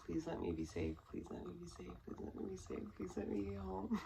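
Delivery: soft, breathless whispered plea, retold; whispered prayer. Wife silently praying to God to get home safe. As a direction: pray under your breath, frightened. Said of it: "praying to God".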